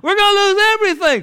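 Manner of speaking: very high, loud panicked wail; hammed-up wail of a ruined father; mock whining. Father gathering his kids over the bills, mock wailing. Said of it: "whining, griping".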